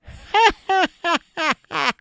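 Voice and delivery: high, loud cackle; malicious witch laugh. A narrator's reading. Witch laughs at the approaching children, high malicious cackle. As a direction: cackle in a high, falling run. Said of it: "she laughed maliciously".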